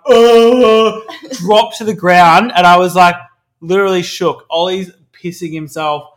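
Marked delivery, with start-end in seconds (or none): 0.1-1.0 loud, higher startled gasp of oh. Retold or imitated. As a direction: yelp it as if something just flew at you. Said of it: "I'm like".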